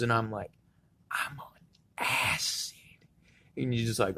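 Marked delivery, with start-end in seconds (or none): 1.1-2.8 hushed mock-secret whisper; whispered confession.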